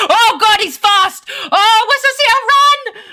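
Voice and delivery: high, loud panicked yelps; frantic squeals of alarm; panicked game shouts. Real, not acted. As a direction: yelp it out as you flee.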